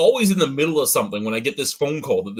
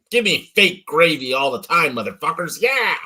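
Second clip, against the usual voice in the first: louder, half-joking rant about gravy; mock rant; 3 semitones higher, 5 dB louder than usual. Real, not acted. Fed-up customer ranting at the chain, loud mock rant.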